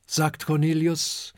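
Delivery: plain reading voice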